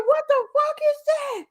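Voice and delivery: high, disbelieving; retold shocked cry; shocked exclamation. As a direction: fire it off stunned. Quoting [name]'s reaction.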